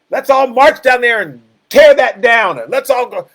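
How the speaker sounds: rally the crowd to tear it down; riled-up mob. Retold or imitated.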